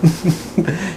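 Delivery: light real laugh. Real, not acted. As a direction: let a short laugh slip out. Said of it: "you're cracking me up".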